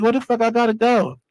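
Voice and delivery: can't believe they're moving him; incredulous. Retold or imitated. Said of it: "I'm like".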